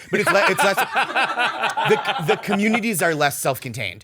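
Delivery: put-on light, laughing Boston accent. Explaining modern Orthodoxy in a laughing Boston accent. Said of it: "in a Boston accent".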